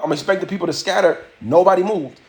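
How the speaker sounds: ordinary delivery, nothing marked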